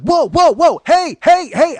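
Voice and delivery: high, very loud alarmed yells; retold scream at full volume. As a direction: yell to stop a charging dog. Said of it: "I'm screaming".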